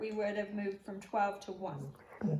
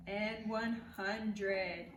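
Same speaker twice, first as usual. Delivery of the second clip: drawn-out finish; 3 dB quieter, much slower than usual. Acted. Slowed final count.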